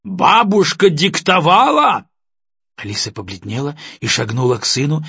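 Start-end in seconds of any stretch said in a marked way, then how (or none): 0.0-2.1 громко, высоко и медленно; прореветь с неверием; рёв. A narrator's reading. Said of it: "взревел Баев". (потрясённый отец ревёт на сына)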